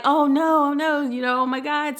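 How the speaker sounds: mock the bystanders' frantic oh no; panicked. Bystanders panicking over the burning car, high imitated panic. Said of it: "They're panicking".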